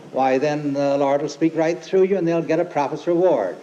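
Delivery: normal talking voice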